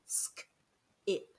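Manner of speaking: sk whispered, then ip; split blend. Shown as a demonstration. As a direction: say sk, pause, ip. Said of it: "more than one letter".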